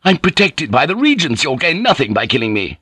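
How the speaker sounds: high shriek that drops back. A narrator's reading. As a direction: plead protection in a high-pitched panic. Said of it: "Jozen shrieked".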